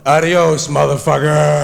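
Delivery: loud, tough American send-off; American adios. Retold or imitated. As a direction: bark it like a rude American. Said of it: "the American version of that one".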